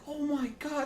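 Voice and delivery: put-on high, overjoyed kid voice; excited. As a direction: gasp it out like a thrilled kid. Kid opening Xbox box.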